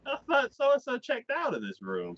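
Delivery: high-pitched grandma impression; puzzled old lady, voice pushed way up; grandmother. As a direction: imitate a grandmother wondering aloud. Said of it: "the grandmother's like".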